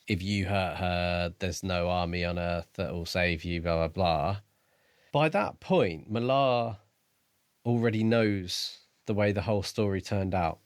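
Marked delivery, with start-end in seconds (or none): none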